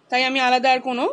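normal talking voice

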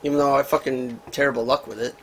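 usual speaking voice

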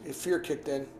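ordinary delivery

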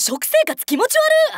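high, loud-leaning comic retort; hammed-up straight-man disgust; disgusted exclamation. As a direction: recoil with an appalled cry.

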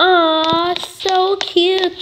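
high, drawn-out coo at a kitten. Pretend. Player fawning over a new game kitten, cooing. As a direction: coo adoringly over something tiny.

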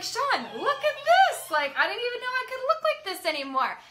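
high, loud gushing to a partner; loud, high-pitched amazed delight; excited squeal. Retold or imitated. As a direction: show off, thrilled, pitch way up. Excited showing off to husband.

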